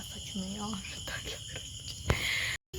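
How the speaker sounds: slow, hushed whisper of fear; scared whisper. Real, not acted. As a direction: whisper it, barely voiced. Scared, hiding in car. (डरी हुई धीमी फुसफुसाहट)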